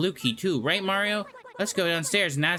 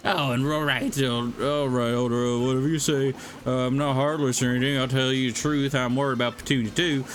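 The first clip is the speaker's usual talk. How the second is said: lightly voiced gruff rural accent; a hint of a scornful country accent; gruff villager accent. Villager gives in.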